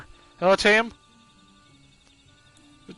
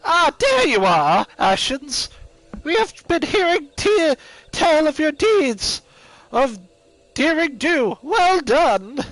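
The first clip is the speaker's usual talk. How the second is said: put-on grumpy old man; 8 semitones higher, 5 dB louder, slower than usual. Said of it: "I gotta prepare my old man voice".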